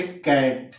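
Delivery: कैट said slowly with a K at the start; slow teaching model of K in कैट; hard C as K. Shown as a demonstration. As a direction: demonstrate कैट with C sounded as K. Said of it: "तो क होगा".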